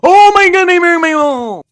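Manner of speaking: long, high, very loud shriek; startled scream. Real, not acted. Gamer suddenly frightened mid-game, long scream. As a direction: scream it out, drawn way out.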